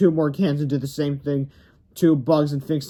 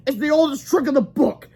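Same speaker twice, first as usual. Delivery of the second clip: much higher gruff grown-up; principal voice; 11 semitones higher, 4 dB louder than usual. Acted. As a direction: explain it's shaving cream.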